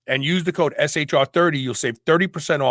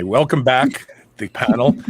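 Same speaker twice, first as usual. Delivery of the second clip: ordinary talk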